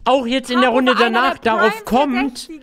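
loud, high furious rant; angry gamer rant. Real, not acted. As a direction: rage loudly at the game. Raging at the game, shouting. (wütender Gamer-Ausraster)

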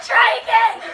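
loud call. Real, not acted. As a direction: shout it out, laughing. Calling out after a fall.

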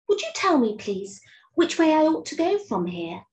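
Alice's voice. Acted. Alice asking the cat which way to go, high and polite. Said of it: "thought Alice, and she went on".

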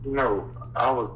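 ordinary talk, nothing marked

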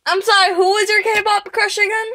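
high, loud called-out question; loud teasing question. Real, not acted. Vlogger quizzing friend about a crush, loud and high. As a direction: call it out to tease a friend.

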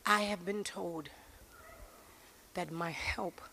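slow, low and soft preaching; measured preacher, long pauses between short phrases; solemn preaching. Real, not acted. Slow, solemn opening of sermon.

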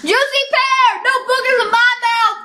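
excited shout. Real, not acted. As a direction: cheer it out with relief. Relief at avoiding booger.

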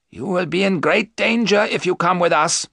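high, urgent caution; warning professor. Acted. Professor warns Mina against coming along, urgent.

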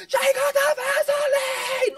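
high, loud, slow crowd yell; crowd shout. Retold or imitated. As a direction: shout it out like a roaring audience. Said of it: "they're like".

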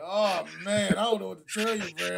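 loud gloating taunt; gloating Packers. Retold or imitated. Packers defense taunts the Rams, loud mock gloat. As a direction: crow it at the Rams. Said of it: "rubbing their nipples like".

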